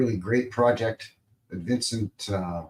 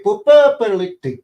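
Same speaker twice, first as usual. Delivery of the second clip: sound effect noises; 11 semitones higher, 11 dB louder than usual. Pretend. Mimicking clicking sound effects.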